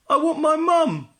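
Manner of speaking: very high, loud wailing howl. A narrator's reading. Little Wolf howls for his mum, high and loud. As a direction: howl for your mother. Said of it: "howled Little Wolf".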